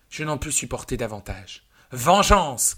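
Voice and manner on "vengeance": higher, much louder outcry; cry for vengeance. Acted. The narrator's heart crying out for vengeance, loud. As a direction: hurl the word out in rage. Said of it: "cria mon cœur".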